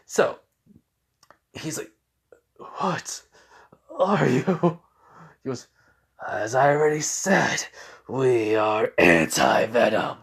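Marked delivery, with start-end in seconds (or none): 2.8-4.8 lightly voiced creature, higher and halting; slightly eerie symbiote question. Venom figure speaks in retold story. 6.3-10.2 slow, drawn-out creature voice; put-on monster voice, stretched words; symbiote voice. Symbiote announces its name in story.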